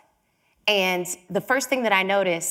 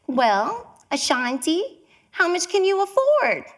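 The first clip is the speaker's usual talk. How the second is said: little tiny voice; 9 semitones higher than usual. Retold or imitated. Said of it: "she had this little tiny voice".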